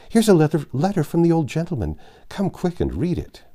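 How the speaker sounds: high, loud joyful calling from the window; joyful scream. Acted. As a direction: shout the news up the street, thrilled. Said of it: "several joyful voices screamed".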